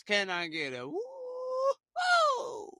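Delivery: sky-high drawn-out call for a woo-hoo; preacher-style hype call. Acted. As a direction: stretch it way up like rallying a crowd.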